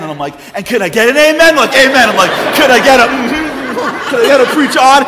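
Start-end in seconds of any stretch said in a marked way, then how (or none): 0.5-1.6 high, shouted plea to congregation; rousing preacher call. Retold or imitated. Speaker all in asks church crowd, rousing shouted call. 1.7-2.1 high, loud mock congregation amen; crowd shouting back. Congregation answers the speaker, loud high shout. 2.6-5.0 high, loud revival-style appeal; rousing preacher call. Retold or imitated. Speaker begs crowd for more responses, rousing shouted call.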